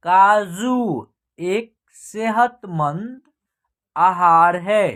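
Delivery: word by word with drawn-out vowels; measured reading practice, pausing between phrases; slow direct reading. Shown as a demonstration. Teacher teaching learners to read these sentences, slow and deliberate. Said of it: "इनको हम direct पढ़ना सिखाएंगे".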